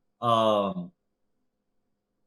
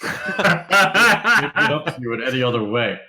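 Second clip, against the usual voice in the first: laughing through words; 5 dB louder than usual. Real, not acted.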